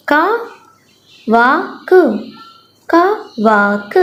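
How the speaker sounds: का वाक sounded out then joined slowly; teacher reading for kids; slow blending. Shown as a demonstration. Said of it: "मैं दोबारा से पढ़ती हूँ".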